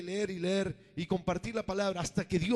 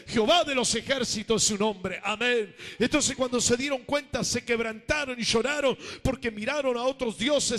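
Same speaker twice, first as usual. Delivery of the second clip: rising, louder preacher's cry; fervent preaching; 2 semitones higher, 4 dB louder than usual. Acted. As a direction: build the congregation up to a fervent peak. Preaching crescendo about Babylon.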